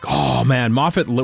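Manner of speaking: usual speaking voice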